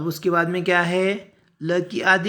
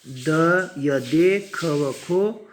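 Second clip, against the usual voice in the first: very slow letter-by-letter spelling; deliberate, separated syllables for learners; spelling-out recitation; much slower than usual. Shown as a demonstration. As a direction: spell it aloud, stretching each sound. Teacher spells the word out.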